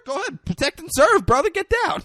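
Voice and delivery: high, loud mocking encouragement; hammed-up goading; Denzel Washington. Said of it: "And Denzel's like".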